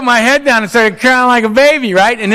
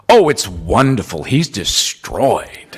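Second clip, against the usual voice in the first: much lower, very slow, savoring aside; delighted aside; 10 semitones lower, much slower than usual. Retold or imitated. Sponsor Donald tells assistant Earl is destroyed, low slow purr. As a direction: purr it slowly over his head.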